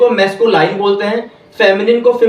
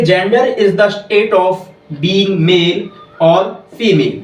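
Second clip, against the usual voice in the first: very slow, every English word spaced out; slow, clear dictation for students to copy; much slower than usual. Shown as a demonstration. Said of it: "इसकी बहुत ही छोटी और simple सी definition है".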